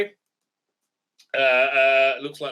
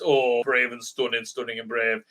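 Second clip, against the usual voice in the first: low, sarcastic chant of praise; mocking slogan; 7 semitones lower than usual. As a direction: drone the slogan with mock reverence.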